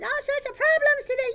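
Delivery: high, loud, fast puppet squeal; excited puppet. Pretend. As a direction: burst in as an overexcited puppet. Puppet Sally breaks in excitedly.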